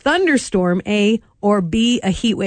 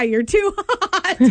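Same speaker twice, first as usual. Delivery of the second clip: laughing; 6 semitones higher, slower than usual. Real, not acted. Laughing at her own point.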